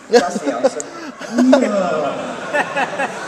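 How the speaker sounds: loud, laughing punchline. Real, not acted. Joking with the audience about the answer, laughing loudly.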